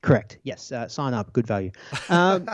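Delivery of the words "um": trying not to laugh and failing; stifled giggles. Real, not acted. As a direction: giggle breaking through a high um. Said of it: "can't keep a straight face".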